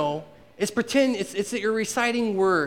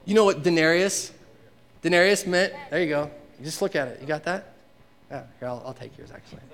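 ordinary delivery

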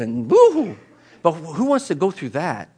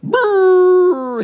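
dismayed mom; 13 semitones higher, 6 dB louder than usual. Retold or imitated. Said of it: "the mom just goes".

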